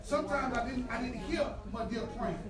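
quiet preaching. Acted. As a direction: bring the church down to a hush about tears. Tender quiet sermon passage.

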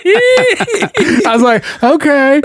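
high, slow words through laughter; giggling okay; laughing okay. Retold or imitated. Laughing retelling of dancer line.

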